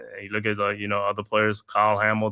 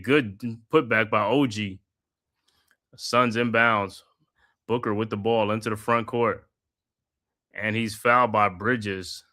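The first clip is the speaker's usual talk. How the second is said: steady broadcaster's call of the action; live basketball play-by-play commentary. Acted. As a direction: call the game like a courtside announcer. Calls the game play by play.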